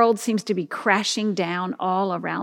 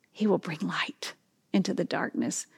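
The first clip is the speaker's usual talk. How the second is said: hushed whisper; 2 semitones lower, 8 dB quieter than usual. Acted. Hushed promise of light.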